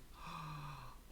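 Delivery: soft, drawn-in gasp of amazement; re-enacted gasp of happy shock; astonished gasp. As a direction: gasp in delighted disbelief. Acted gasp at good news.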